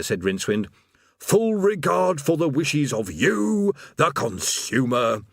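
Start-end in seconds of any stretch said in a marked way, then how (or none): 1.2-5.2 slow demon slogan, rising high then dropping; drawn-out, declaimed sales patter; stoic recital. A narrator's reading. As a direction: plough on stoically through the script. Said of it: "the demon continued stoically".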